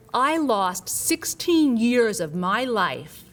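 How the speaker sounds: slow, loud, bitter outburst; furious patient voice, drawn out; angry patient. Retold or imitated. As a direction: hammer out the lost years. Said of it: "he's not joking".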